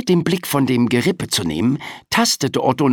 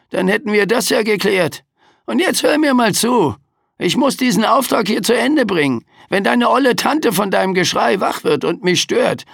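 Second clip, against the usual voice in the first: high, sarcastic, weary Reaper; overworked Death complaining; grumpy Grim Reaper; 11 semitones higher, 3 dB louder than usual. A narrator's reading. Death lectures the boy. (mürrischer Sensenmann)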